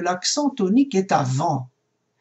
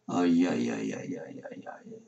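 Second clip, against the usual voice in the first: very soft, breath-only lament, trailing away; hushed dismay; 14 semitones lower, 8 dB quieter than usual. Real, not acted. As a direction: mutter it under your breath as a mock-worried aside. Aparté devant la difficulté de l'hébreu. (consternation chuchotée)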